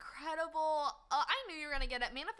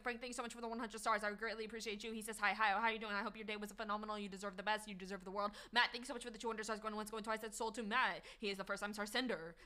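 quick, lower auctioneer patter; 5 semitones lower, 4 dB quieter than usual. Acted. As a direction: rattle it off like an auctioneer. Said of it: "you like my auctioneer voice".

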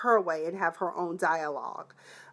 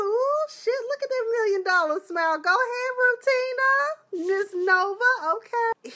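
hyped cheering; 12 semitones higher than usual. Real, not acted. Cheering on Miss Nova from the couch, high and excited. Hyping the character on.

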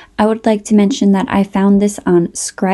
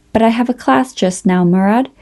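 ordinary delivery, nothing marked

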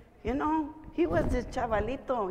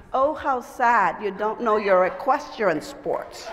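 slow, mock-pitying condescension; drawn-out, emphatic mock sympathy; turning the tables; 4 dB louder, slower than usual. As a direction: mimic the snooty boy right back. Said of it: "She goes".

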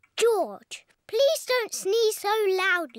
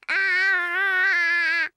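saying ah; 3 semitones lower than usual. Shown as a demonstration. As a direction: open wide and say "Ah.". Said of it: "Can you show George how to say, "Ah"?".

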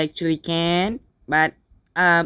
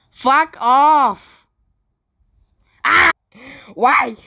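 raised, loud gamer rage; frustrated yelling; 6 semitones higher, 6 dB louder, much slower than usual. Real, not acted. Player fed up with being killed, yelling. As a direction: yell it in exasperation. Losing in the game.

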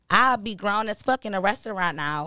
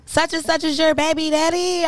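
high nosy gossip; 7 semitones higher, 3 dB louder than usual. Retold or imitated. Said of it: "retelling people asking".

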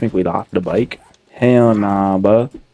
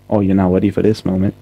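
normal talking voice

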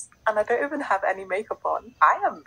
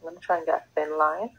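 ordinary talk

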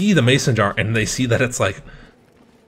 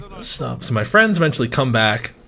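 ordinary delivery

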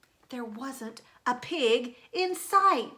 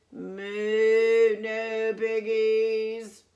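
drawn-out, very slow moo voice; slow cow voice; 5 dB louder, much slower than usual. A narrator's reading. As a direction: say it like a placid cow. Cow answers the witch.

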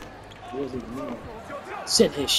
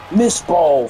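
ordinary delivery

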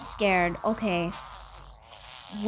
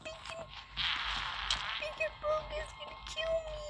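much higher, piping panicked voice; squeaky scared voice; 4 dB quieter than usual. Pretend. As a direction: squeak as a tiny scared character. High squeaky voice in game.

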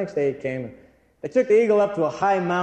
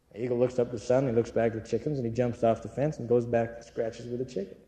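ordinary talk, nothing marked

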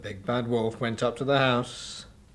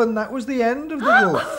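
laughing; 11 semitones higher, 7 dB louder than usual. Real, not acted. Teller laughs at climax.

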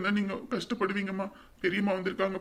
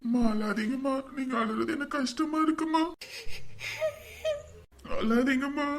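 pleading comfort; 6 semitones higher than usual. Acted. Son comforting crying mother.

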